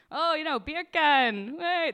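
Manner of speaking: put-on a clumsy stab at a Jamaican accent. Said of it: "the only word I can do in Jamaican accent".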